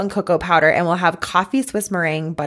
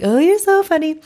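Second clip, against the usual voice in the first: amused teasing; 9 semitones higher than usual. Retold or imitated. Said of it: "And they're like".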